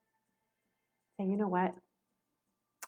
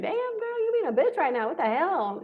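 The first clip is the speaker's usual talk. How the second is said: high, sassy scolding inner voice; inner critic voice; 11 semitones higher, 4 dB louder than usual. Retold or imitated. Mother catches herself being short with kids, sassy high scold. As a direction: pitch way up like a snarky friend calling you out. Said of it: "that little voice in the back of my head".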